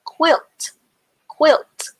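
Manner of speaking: clear model word. Shown as a demonstration. Teacher modelling quilt for young spellers. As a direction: announce quilt brightly for the class. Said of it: "I has the I sound in this word".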